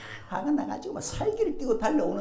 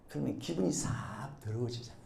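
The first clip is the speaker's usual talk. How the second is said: usual speaking voice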